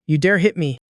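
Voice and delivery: plain reading voice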